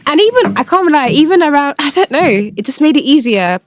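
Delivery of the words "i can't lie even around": ordinary talk, nothing marked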